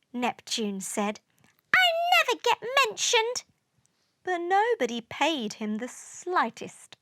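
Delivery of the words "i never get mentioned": fast, squeaky complaint; high-pitched whine. A narrator's reading. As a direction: whine about being left out. Ignored Neptune complains.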